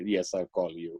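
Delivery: low, soft dutiful reply; son promising compliance. Retold or imitated. Son to his mother to get the keys, dutiful promise. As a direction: give in like an obedient teenager. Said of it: "she extracts all kinds of promises".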